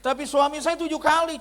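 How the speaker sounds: high, loud mock-wife voice; protesting wife. Wife defending herself to the pastor, high loud mock protest. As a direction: imitate a wife loudly pleading her case, pitched right up. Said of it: "Dia bilang begini".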